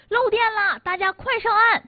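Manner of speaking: high, loud urgent yell; acted warning shout. Young man warning swimmers of leaking electricity, shouting urgently. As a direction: shout it high across the water. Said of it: "却看到游泳池对面的他大喊".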